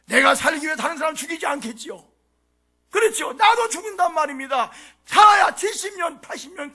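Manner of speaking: loud, high sermon cry; full-force preaching. Acted. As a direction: drive every point home from the pulpit. (힘차게 외치는 설교)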